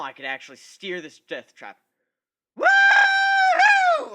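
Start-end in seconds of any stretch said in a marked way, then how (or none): 2.6-4.1 very high, loud whoop; thrilled downhill whoop; yell. Acted. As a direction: whoop with joy riding the log. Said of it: "I yelled".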